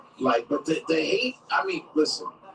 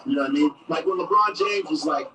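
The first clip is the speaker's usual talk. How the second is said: ordinary talk, nothing marked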